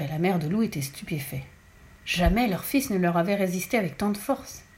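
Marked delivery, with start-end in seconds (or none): none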